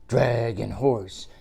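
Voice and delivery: ordinary delivery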